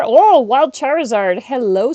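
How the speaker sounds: excited. Real, not acted. Spotting a wild Charizard.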